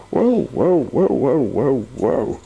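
high whoas that fall away; amazed whoas. Acted. Wizard eyeing rolling money, fading amazed whoas. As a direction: marvel at the coins, winding down.

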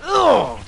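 sharp high strained grunt; effortful grunt. Real, not acted. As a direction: grunt as if taking a hit. Straining during game fight.

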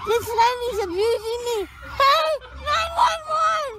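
high, drawn-out wailing yell; distressed shouting. Real, not acted. Groggy patient protests, calls for help.

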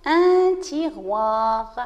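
"un tiroir" drawn out at half pace; slow word model. Shown as a demonstration. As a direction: stretch the word slowly for pupils. Teacher models a new word. (mot modèle, lentement étiré)

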